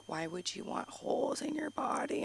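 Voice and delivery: hushed motherly disbelief; imitated appalled whisper. Comic imitating mom objecting to piercings, appalled whisper. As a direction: whisper it as if scandalised by the idea.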